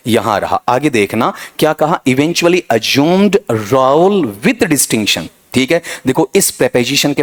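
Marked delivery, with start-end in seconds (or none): 2.1-5.3 reading the question aloud very slowly; slow dictation. Shown as a demonstration. Teacher dictating a test sentence to students, slowly. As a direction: spell out each word like dictation to a class.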